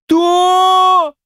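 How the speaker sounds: very high, long-drawn question; astonished. A narrator's reading. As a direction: stretch the word out high in amazement. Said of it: "изумились бабы". (изумлённо, высоко и протяжно)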